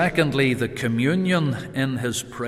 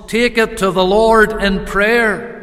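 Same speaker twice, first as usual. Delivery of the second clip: ringing call, higher and louder; preached out loud; 6 semitones higher, 6 dB louder than usual. Real, not acted. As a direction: proclaim it to the whole church. Preacher exhorts congregation.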